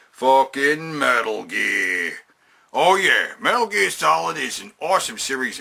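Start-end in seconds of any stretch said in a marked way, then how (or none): 0.1-2.1 slow, louder, drawn-out big reveal; dramatic reveal. Acted. Vlogger revealing his number one pick, slow dramatic boom. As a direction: unveil the winner like a trailer voice.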